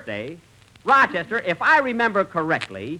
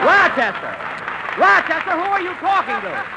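calling out; 11 semitones higher than usual. Real, not acted. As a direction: yell down the line after him. Calling after Rochester on phone.

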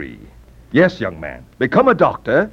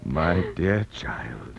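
tender; 5 semitones lower, 7 dB quieter, much slower than usual. Acted. Father rises softly to daughter.

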